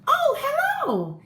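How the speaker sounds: high, slow, drawn-out "oh, hello!"; surprised hello. Acted. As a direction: caught mid-rescue, startled and bright. Egg greets the listener.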